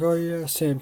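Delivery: high, loud call; dad calling out. Retold or imitated. Dad calling to Sam to check his son, loud. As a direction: call across the room like dad.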